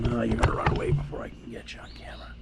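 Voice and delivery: softly whispered so the squirrel stays. Real, not acted. Filming the squirrel, a real whisper. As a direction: hush it so the animal won't bolt.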